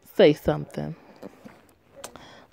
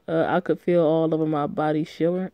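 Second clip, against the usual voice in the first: ordinary delivery, nothing marked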